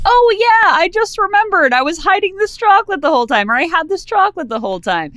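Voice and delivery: very high put-on voice, starting loud and easing off; mock sudden recollection. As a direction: play a hammed-up "just remembered" excuse, rattled off quickly. Acts out Frank's sudden fake recollection.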